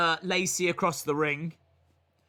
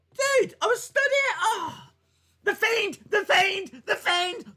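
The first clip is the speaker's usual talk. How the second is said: frantic high yell; 11 semitones higher than usual. Pretend. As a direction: shriek the name like a last resort. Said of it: "You'd be like".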